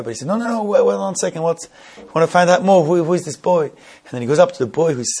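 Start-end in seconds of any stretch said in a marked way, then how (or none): 0.3-3.6 higher and louder, stopping the apology short; eager interruption. Retold or imitated. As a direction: cut in, keen to hear more. Minister halts the apology.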